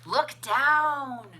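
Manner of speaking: ordinary delivery, nothing marked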